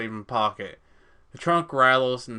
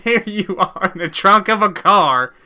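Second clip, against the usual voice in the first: loud, high laughing disbelief; 7 semitones higher, 10 dB louder than usual. Real, not acted. Laughing, back in trunk.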